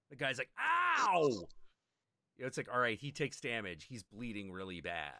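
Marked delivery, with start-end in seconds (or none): 0.6-1.5 a sudden high, loud "ow"; pained yelp. Retold or imitated. Stabbed player crying out at his attacker, high and loud. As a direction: yelp like you've just been stabbed.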